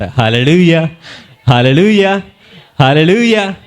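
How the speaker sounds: loud, ringing hallelujahs; hallelujah shout. Real, not acted. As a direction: call out praise with full force. Preacher exclaims praise again. (ఉత్సాహంతో హల్లెలూయా అరుపు)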